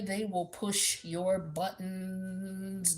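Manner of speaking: very slow, stretched warning; drawn out. Acted. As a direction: drag every word out slowly. Warning about a petty partner.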